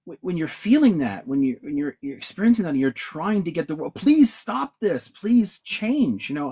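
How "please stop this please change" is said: high, slow mock plea; pleading. Friend voices someone begging the world, high slow mock plea. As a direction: plead up high and drawn out like a desperate appeal.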